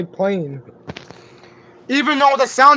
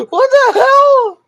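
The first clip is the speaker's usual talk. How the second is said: high, loud, drawn-out laughing disbelief; laughing hard; 13 semitones higher, 4 dB louder, slower than usual. Real, not acted. Streamer reacting to the cat's antics, laughing hard. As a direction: crack up, then squeal it out slowly. Cracking up at the cat.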